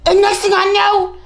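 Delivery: ordinary talk, nothing marked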